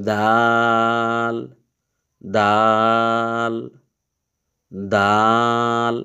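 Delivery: দাল said very slowly and clearly; slow teacher demo of দাল; plain dal. Shown as a demonstration. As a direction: stretch দাল out slowly and evenly. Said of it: "নরমালভাবে".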